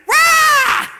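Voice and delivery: a huge high, loud "Rah!"; loud wife exploding at her husband over a small problem; roar. Retold or imitated. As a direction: blast it like an outburst of temper. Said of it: "and she's like".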